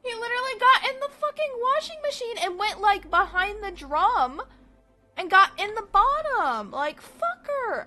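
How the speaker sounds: high, loud indignant rant; exasperated, mad. Real, not acted. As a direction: vent at full tilt about a maddening pet. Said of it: "I'm very mad at my snake".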